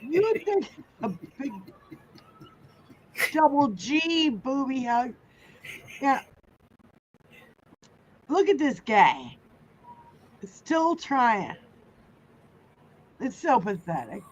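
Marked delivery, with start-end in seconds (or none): none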